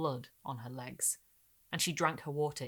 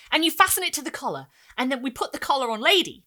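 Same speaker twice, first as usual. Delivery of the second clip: high, loud, racing to tell; eager boy; 8 semitones higher, 10 dB louder than usual. Acted. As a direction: grab the story from your sister.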